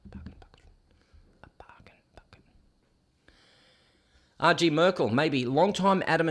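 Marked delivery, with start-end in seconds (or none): 0.1-0.6 whispered echo of the order. Pretend. Streamer whispers the fast-food order to himself. 1.4-2.4 faint, quick whisper. Pretend. Streamer repeats the order in a faint whisper.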